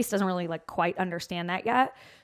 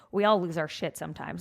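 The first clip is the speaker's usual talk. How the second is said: ordinary talk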